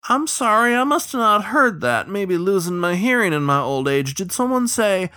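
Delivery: high, loud, feigned innocence; mock-deaf old riverman. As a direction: play hard of hearing, high and needling.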